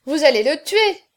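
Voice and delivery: high, loud outcry; alarmed protest. A narrator's reading. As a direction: cry out in protest. Women protest at the drinking.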